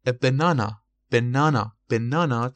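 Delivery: banana repeated clearly with a British 'ah', middle syllable loud; British pronunciation. Shown as a demonstration. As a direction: punch the long 'nah' in British banana. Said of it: "تلفظ بریتیششه". (تلفظ بریتیش banana با «آ»ی وسط)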